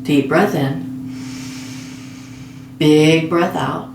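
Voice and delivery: slow, measured meditation voice with long pauses; guided meditation. Real, not acted. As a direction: guide a listener gently toward sleep, phrase by phrase. Leads a guided sleep meditation.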